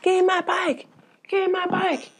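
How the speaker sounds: high-pitched screeched demand; screaming. Retold or imitated. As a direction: scream it like a tantrum on the porch. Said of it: "And he's screaming".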